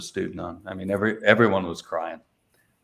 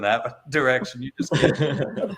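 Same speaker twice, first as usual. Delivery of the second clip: real laugh breaking into the words; higher, laughing through the punchline; 5 semitones higher, slower than usual. Real, not acted. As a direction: chuckle your way through it. Laughs while telling funny anecdote.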